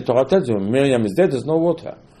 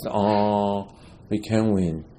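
lower, softer mock defeat; imitated dejection; 5 semitones lower, 6 dB quieter, much slower than usual. Am Yisrael giving up before Amalek, mock dejected. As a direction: sigh it out like a beaten crowd. Voicing the defeated people's complaint.

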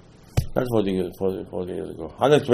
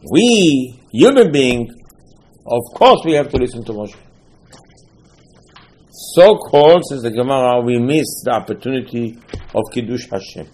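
usual speaking voice

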